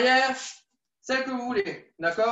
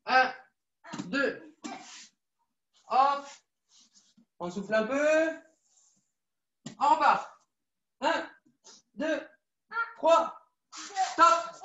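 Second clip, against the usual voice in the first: coach calling drills; 5 dB quieter, slower than usual. Real, not acted.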